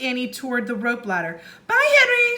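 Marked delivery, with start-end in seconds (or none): 1.7-2.4 put-on high, loud child's farewell; high calling goodbye. A narrator's reading. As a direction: call out a quick goodbye. Annie calls goodbye to pterodon.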